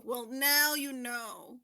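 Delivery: slow, stretched-out repeat; teasing, drawn out. Real, not acted. Host answering a contestant who never saw the meme, drawn out. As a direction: draw each word out teasingly.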